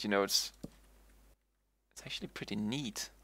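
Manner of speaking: ordinary talk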